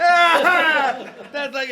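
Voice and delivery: very loud, high roaring laugh; a big, loud, table-banging laugh; imitated huge laugh. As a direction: roar like De Niro banging the table.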